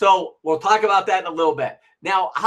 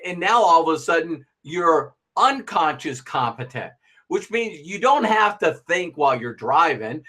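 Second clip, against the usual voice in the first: usual speaking voice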